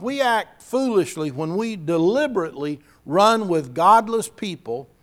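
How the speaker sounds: ordinary delivery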